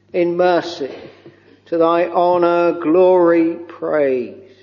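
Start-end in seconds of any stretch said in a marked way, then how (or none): none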